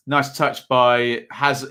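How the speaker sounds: ordinary talk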